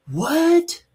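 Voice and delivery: high, startled mock "What?"; shocked what. Preacher voicing his audience's shocked reply. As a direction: play the stunned listener who can't believe it. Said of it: "some of y'all are going".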